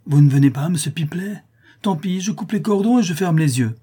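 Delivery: high, impatient call from upstairs; calling a threat. A narrator's reading. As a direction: warn him loudly from above.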